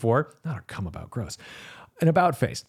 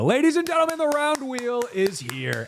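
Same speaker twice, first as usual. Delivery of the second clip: mock showman announcer; 13 semitones higher than usual. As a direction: unveil it like a hammed-up arena announcer, stretching the last words. Mock showman unveiling the round wheel.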